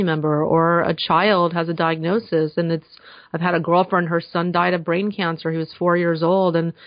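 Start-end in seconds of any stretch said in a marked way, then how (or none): none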